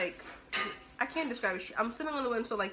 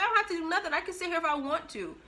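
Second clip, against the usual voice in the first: retold retort; 7 semitones higher, faster than usual. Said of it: "That's exactly what I told her".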